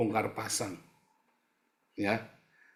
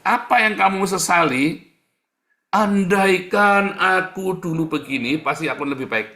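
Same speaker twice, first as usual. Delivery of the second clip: mock regret cry; 8 semitones higher, 12 dB louder than usual. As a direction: ham up the regretful sinner. Mocking a regretful complainer. (ratapan penyesalan yang dibuat-buat, keras dan tinggi)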